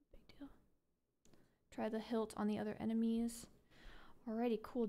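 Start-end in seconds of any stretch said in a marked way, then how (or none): 0.1-0.5 whispered aside. Real, not acted. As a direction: breathe it under your breath.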